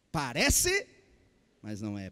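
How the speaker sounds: Parece called out loud, mas não é dropped low; punchy slogan. Retold or imitated. Preacher hammering the ad slogan to the congregation, loud then low. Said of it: "tinha uma propaganda, dizia".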